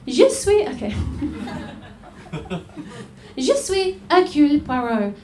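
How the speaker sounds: badly faked French accent as Poirot; a hammed-up French detective voice; terrible French accent. Detective introducing himself to the audience in a terrible French accent. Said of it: "a very terrible, um, French accent".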